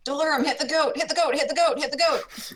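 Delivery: high, loud, repeated battle plea; urgent yell. Acted. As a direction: yell frantically from the back of the group. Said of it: "to the back of the group and yell".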